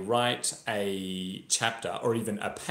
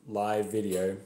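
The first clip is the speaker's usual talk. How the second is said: ordinary delivery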